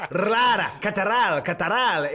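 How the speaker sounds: mock Latin American rolled names; hammed-up Spanish pronunciation; imitating a Spanish-accented announcer; South American accent. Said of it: "he'll go".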